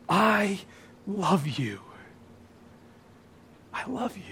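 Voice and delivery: soft, then a breathy whispered last line; fading to a whisper. Acted. Pastor voicing God to the church, fading into a whisper. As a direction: let God's words die away to a whisper.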